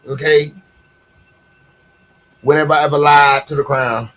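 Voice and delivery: ordinary talk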